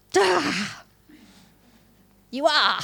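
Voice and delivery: high, pointed comic "duh"; God's teasing reply. Retold or imitated. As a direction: answer as if it's blindingly obvious, playfully. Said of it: "this very quiet little voice say".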